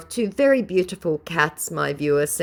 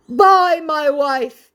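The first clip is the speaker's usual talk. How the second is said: high, loud, slow cooing; mock scammer voice; 12 semitones higher, 9 dB louder, much slower than usual. Vlogger mocking the scammer's clingy goodbye, high and slow. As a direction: coo it high and drawn out. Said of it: "he tries again".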